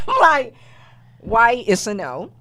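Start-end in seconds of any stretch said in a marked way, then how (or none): 0.0-0.5 laughing. Real, not acted. Laughing at the criteria.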